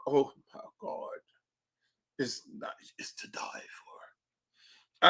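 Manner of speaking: slow breathless whisper; swooning whisper. Real, not acted. Raving about Baton Rouge women, whispered slowly. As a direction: sigh it out, slow and dreamy.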